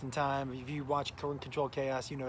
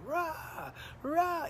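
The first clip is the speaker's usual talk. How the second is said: rapid retches; 12 semitones higher than usual. Retold or imitated. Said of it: "six or seven in a row".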